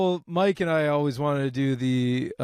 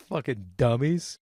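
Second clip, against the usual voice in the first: normal talking voice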